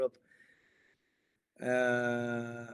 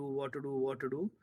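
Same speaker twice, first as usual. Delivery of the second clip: normal talking voice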